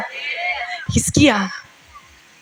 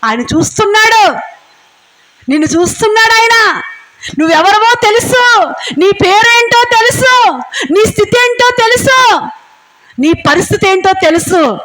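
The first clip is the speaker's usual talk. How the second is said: high, loud proclaiming sermon voice; preaching at full force; 7 semitones higher, 4 dB louder than usual. Acted. Preacher proclaiming to the church that God sees them, full force preaching. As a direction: thunder it out to the congregation, rising.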